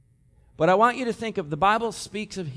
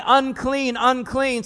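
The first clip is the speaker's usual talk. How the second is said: raised, higher call of unclean; crying out unclean; 7 semitones higher, 6 dB louder, slower than usual. Acted. Leper crying out to warn people, raised high call. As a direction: call out the warning to all around. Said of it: "He shall cover his upper lip and cry".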